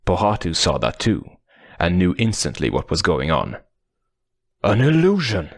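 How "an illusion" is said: high urgent cry. Acted. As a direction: call it out loud and high. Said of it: "he cried out".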